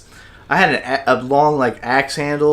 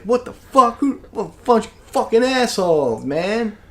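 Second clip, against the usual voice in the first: raised-pitch indignant griping, voice up high; mock outrage; 8 semitones higher than usual. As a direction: act out being fed up with the jerks shooting at you. Retold outrage at shooters.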